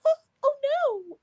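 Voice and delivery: high, whimpering mock fear; hammed-up frightened whine. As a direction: squeak it out like a scared girl. Timid Tess, scared whimper.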